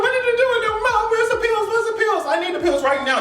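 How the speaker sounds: shrill panic, begging for pills; frantic pleading. Retold or imitated. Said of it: "She crying".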